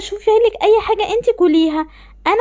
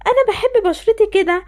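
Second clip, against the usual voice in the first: ordinary talk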